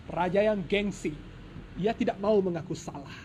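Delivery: plain reading voice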